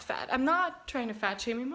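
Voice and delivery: ordinary delivery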